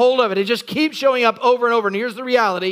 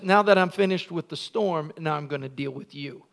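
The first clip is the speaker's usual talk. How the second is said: quiet stern warning; 8 semitones lower, 8 dB quieter than usual. Acted. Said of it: "And then he says".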